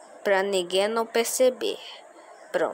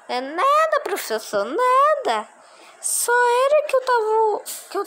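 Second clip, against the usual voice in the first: high squeaky voice, caught out; squeaky pupil voice; 14 semitones higher than usual. Pretend. Pupil caught by the teacher, squeaky guilty excuse.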